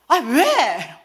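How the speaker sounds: high, loud mock complaint; hammed-up indignant holler; loud accosting. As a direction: demand an answer across the street. Acquaintance loudly accosting her.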